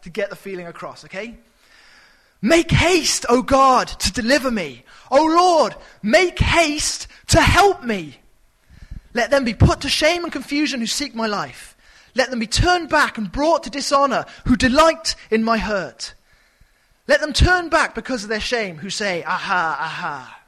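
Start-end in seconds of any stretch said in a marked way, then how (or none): 2.4-16.1 dramatic reading. Shown as a demonstration. Preacher reading the psalm with dramatic urgency. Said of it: "put as much dramatic emphasis into this". 17.1-20.4 dramatic reading. Shown as a demonstration. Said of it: "put as much dramatic emphasis into this".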